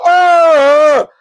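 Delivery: loud, drawn-out "oh"; imitated battle yell. Donna scratching in the fight, imitated loud yell. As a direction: bellow like a fighter lunging.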